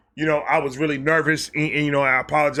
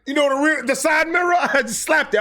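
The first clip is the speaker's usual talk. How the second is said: excited shout; 11 semitones higher than usual. Real, not acted. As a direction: yell it out half laughing. Laughing, reliving embarrassing moment.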